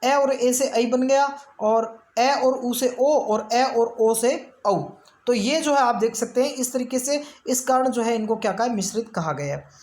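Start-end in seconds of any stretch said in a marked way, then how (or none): 0.0-4.8 vowels drawn out, each one spelled clearly; teacher stretching each vowel so students hear the merging; slow vowel demo. Shown as a demonstration. Teacher showing students how vowels merge, drawn out slowly. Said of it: "मिश्रित स्वर कैसे कि".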